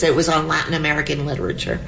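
normal talking voice

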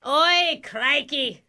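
hammed-up Aussie confession; put-on Australian accent. Caught caller confessing in a fake Australian accent. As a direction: switch to broad fake Australian.

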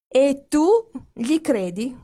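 cried out in disbelief; cry out. A narrator's reading. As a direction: fling the question at him. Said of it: "gridò Helen".

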